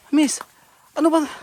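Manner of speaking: high, loud panicked calls; shouting at the woman who attacks him, panicked; alarmed shouting. Real, not acted. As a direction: yell at her to stop.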